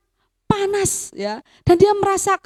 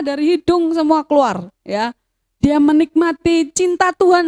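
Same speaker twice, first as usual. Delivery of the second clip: usual speaking voice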